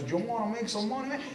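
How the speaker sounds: lightly voiced Scarface gangster; mock gangster pitch; Tony Montana-style hustler. A hint of a hustler repeating his money pitch. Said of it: "talk like that was Tony Montana".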